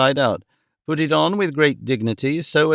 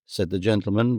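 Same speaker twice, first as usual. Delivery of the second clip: plain reading voice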